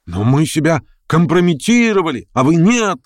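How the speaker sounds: high-pitched indignant outcry; shouted protest. A narrator's reading. Several members accuse Stavrogin, crying out. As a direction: protest as an aggrieved crowd. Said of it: "закричало несколько голосов".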